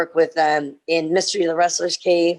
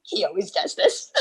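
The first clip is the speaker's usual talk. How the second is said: nervous laugh. Retold or imitated. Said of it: "I go".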